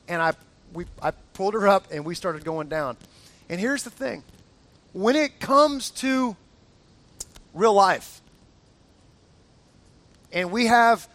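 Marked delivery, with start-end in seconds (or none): none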